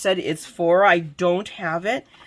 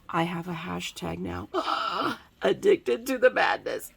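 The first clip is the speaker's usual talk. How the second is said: hushed excitement; 5 dB quieter than usual. Real, not acted. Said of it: "the most exciting part".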